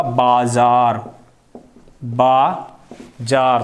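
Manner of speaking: बाजार very slowly with a long आ; correct form, slow. Shown as a demonstration. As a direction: draw out बाजार clearly. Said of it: "शब्द क्या होगा?". (बाजार सही, धीरे-धीरे)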